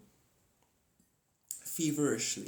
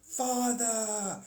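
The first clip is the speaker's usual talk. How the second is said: hammed-up cry; 9 semitones higher than usual. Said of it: "he's like".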